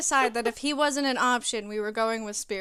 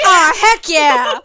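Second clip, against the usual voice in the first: loud, slow cheer; excited shout; 4 semitones higher, 18 dB louder, much slower than usual. Real, not acted. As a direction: belt it out, thrilled. Echoing Trickster excitedly.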